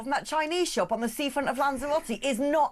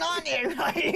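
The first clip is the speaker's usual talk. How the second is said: usual speaking voice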